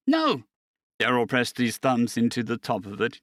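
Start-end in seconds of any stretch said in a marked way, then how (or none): 0.1-0.4 sharp, high cry of alarm; yelled. A narrator's reading. Len startled by Daryl's lunge, high alarmed cry. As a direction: shout it out as he grabs the device. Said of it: "who yelled".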